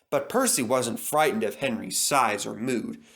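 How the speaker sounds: ordinary delivery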